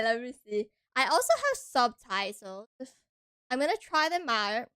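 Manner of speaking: normal talking voice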